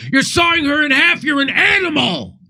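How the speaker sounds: high-pitched shouted impression of an outraged heckler; mock outrage. Comic imitating his friend taking a magic act too seriously, mock-outraged shout. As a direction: yell it in exaggerated, over-serious horror. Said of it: "he took a little too serious".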